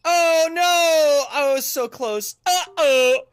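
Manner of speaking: loud, high cry of alarm; dismayed outcry. Real, not acted. Gamer fails just short, dismayed outcry. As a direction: wail it after a near miss.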